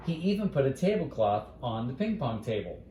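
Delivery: plain reading voice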